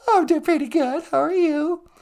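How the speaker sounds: lightly high-pitched wife; high wife voice answering Harry. Acted.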